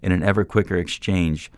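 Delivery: plain reading voice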